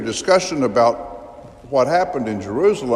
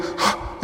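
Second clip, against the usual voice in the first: quick unvoiced gasp; acted breathless gasp; mock gasp; 5 dB quieter than usual. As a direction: catch the breath after the news. Disciples bursting in with news.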